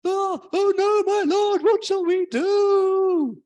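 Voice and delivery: screamed high and slow in mock terror; over-the-top panicked wail; hysterical panic. Shown as a demonstration. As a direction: ham up a shrieking panic. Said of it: "You could go".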